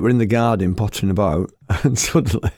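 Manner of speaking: usual speaking voice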